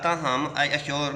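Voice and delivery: ordinary delivery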